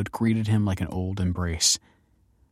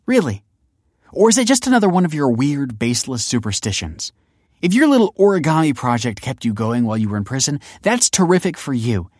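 high, loud, exasperated scolding; scornful tirade; 7 semitones higher, 6 dB louder than usual. A narrator's reading. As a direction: let rip, building louder as you list grievances. Daughter scornfully scolds her father.

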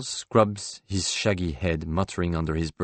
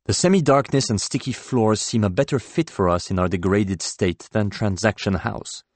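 ordinary delivery, nothing marked

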